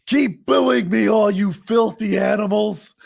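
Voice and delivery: high, loud, slow hammed-up heel voice; mocking heel taunt. Imitating the fighter taunting the Brazilian crowd, slow loud mock villain. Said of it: "And he's like".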